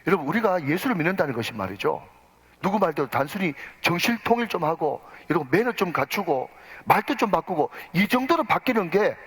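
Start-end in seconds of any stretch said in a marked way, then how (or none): none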